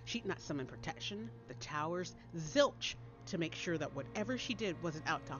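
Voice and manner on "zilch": ordinary delivery, nothing marked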